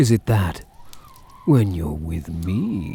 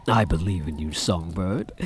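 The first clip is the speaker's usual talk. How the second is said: mock sincere; 3 semitones lower than usual. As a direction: say "I believe in you" gently, as if sincere. Sincere before mocking repeat.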